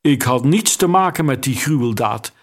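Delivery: usual speaking voice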